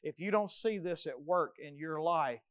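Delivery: ordinary talk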